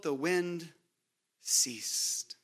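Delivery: the wind ceased, dropped low to a whisper on ceased; hushed ending. A narrator's reading. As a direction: let ceased fade out in a breathy hush. Dramatic stillness after storm.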